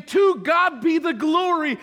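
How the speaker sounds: loud, high mock praise. Preacher mocking the Israelites praising God at the rock, loud. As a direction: ham up the cheap glory. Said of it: "You had no problem saying".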